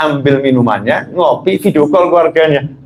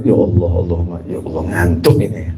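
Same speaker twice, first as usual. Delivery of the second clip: low, drowsy, fading plea; very sleepy; 7 semitones lower, 4 dB quieter than usual. Acted. Drowsy driver calling on God, acted sleepy and low. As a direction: act out fighting sleep at the wheel. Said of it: "Padahal ngantuknya luar biasa".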